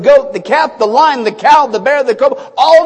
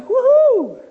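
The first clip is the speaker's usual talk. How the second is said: high, bright woohoo of delight; a sudden high whoop of joy; joyful whoop; 11 semitones higher than usual. Acted. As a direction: cheer it like a kid. Joyful cry about heaven.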